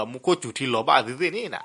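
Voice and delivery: usual speaking voice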